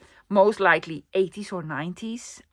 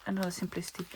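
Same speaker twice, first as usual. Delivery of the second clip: hushed whisper; secretive whispered browsing; 14 dB quieter, faster than usual. Real, not acted. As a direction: whisper so nobody hears.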